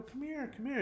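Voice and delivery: high, soft cooing to someone crying; soft high call to a crying friend; tender comforting coo. Retold or imitated.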